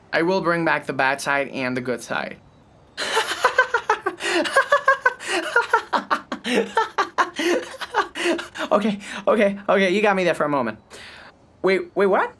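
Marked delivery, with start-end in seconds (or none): 3.0-8.6 high, drawn-out cackle; a long high peal of laughter. Acted. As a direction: crack up at your own joke. Sense of humor laughs long.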